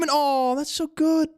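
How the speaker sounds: high delighted squeal, way up in pitch; excited squeal. Real, not acted. Streamer squealing at a chat meme, excited. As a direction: gush with sudden delight.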